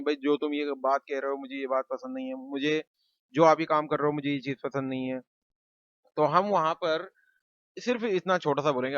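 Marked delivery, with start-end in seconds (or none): none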